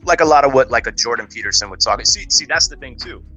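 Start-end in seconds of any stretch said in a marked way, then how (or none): none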